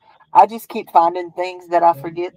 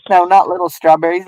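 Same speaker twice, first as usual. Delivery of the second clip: ordinary talk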